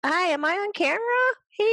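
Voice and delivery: high-pitched excited bystander crashing a broadcast; photobomber. Acted. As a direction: butt in on live TV, high and eager. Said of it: "somebody walks up behind them and they're like".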